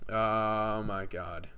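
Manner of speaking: usual speaking voice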